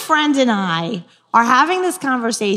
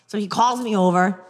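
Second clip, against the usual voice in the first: usual speaking voice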